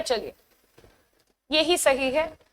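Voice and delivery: ordinary talk, nothing marked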